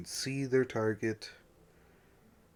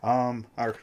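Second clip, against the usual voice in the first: ordinary talk, nothing marked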